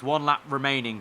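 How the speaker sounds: usual speaking voice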